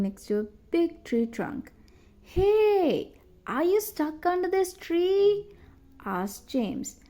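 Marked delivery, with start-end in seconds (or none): 2.2-5.5 calling out. A narrator's reading. Boy calling down to trapped girl.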